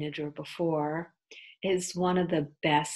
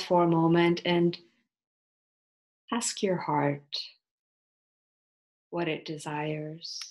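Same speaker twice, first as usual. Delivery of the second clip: unhurried, soothing yoga nidra guidance with long pauses; guided meditation. Acted. Teacher leading listeners into yoga nidra, guided meditation voice.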